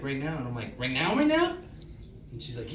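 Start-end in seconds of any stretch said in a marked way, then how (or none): 0.8-1.6 high, loud, incredulous question; mock-panicked double take, swooping up; shocked echo. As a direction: repeat it in rising disbelief. His shocked echo of the doctor.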